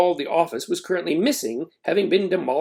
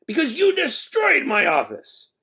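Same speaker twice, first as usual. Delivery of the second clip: accusing principal; 12 semitones higher than usual. A narrator's reading.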